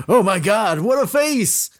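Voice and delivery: high, loud cry of horror; horrified scream. Acted. Colonel screaming at the ghostly face in horror. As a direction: shriek it as if seeing a monster. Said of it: "with a scream of horror".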